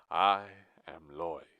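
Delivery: slow, loud villain introduction; menacing villain. Acted. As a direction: announce yourself slowly and heavily. Villain declares his name.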